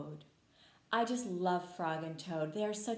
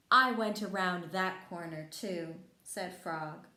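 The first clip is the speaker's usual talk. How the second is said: bright, lively storybook reading, louder and higher; reading to children; 3 semitones higher, 5 dB louder than usual. A narrator's reading. Teacher reading Frog and Toad to kids, lively storybook voice. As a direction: read it out like a teacher at story time. Reads picture book to children.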